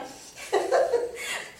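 breathy high giggle; high laugh. A narrator's reading. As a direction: burst into a giggle. Laugh after her joke.

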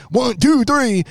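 high, excited one two three count; mock referee count. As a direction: count the pin like an eager referee. Fast referee count imitated.

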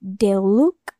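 ordinary talk, nothing marked

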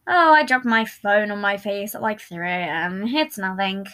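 slow, drawn-out casual excuse; rehearsed nonchalance. A narrator's reading. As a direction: play it down as nothing. Practises casual lie in mirror.

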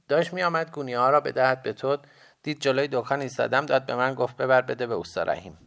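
put-on high, loud boy's voice; young boy. A narrator's reading. Boy character explains the errand.

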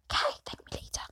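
very soft, cheeky whisper; hushed parting aside. Real, not acted. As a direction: whisper it like a secret tip. Cheeky aside after giving a tip.

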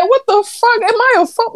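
shrill mock outrage at himself; high disbelief. Runner stunned by his own turf toe, high disbelief. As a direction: squeak it out, stunned. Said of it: "I said".

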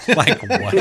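high, loud burst through laughter; real laughing disbelief; incredulous laughter. Real, not acted. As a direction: blurt it out while cracking up. Cracks up at the absurd plan.

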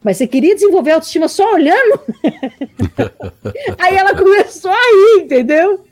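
loud, high retelling that breaks into laughter; amused, laughing. Retold or imitated. Coach retelling her blunt question to a student, laughing. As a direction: burst out laughing as you retell it.

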